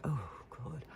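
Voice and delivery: soft, breath-heavy "oh, God"; retold weary near-whisper; exhausted groan. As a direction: let it out under the breath, spent. Retold exhausted relief after dog clash.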